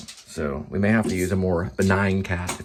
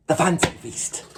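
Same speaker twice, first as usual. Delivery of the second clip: mock presenter; 8 semitones higher, slower than usual.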